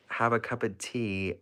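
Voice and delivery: English accent attempt. Shy streamer trying it for UK viewers, English accent. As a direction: try a line in an English accent. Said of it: "if I do your accent".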